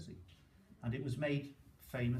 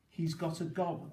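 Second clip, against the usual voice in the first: lightly posh English accent, raised pitch; 5 semitones higher, 4 dB louder than usual. Shown as a demonstration. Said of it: "I'm saying it in a posh accent here".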